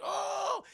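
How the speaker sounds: high drawn-out "oh" of getting whacked; imitated yelp on being hit; mock pained cry. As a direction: cry out as if struck. Voices Tucker being whacked.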